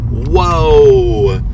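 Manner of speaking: usual speaking voice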